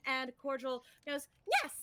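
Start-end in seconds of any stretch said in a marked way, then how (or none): 1.6-1.7 higher, sugary fairy voice; sweet and eager, like a sparkly helper; Cordial's voice. Acted. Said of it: "Pretty good cordial".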